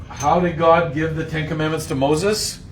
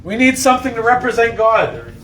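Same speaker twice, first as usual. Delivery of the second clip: high, louder complaining crowd; whiny Israelites; 9 semitones higher, 5 dB louder than usual. Acted. People voiced after Moses left.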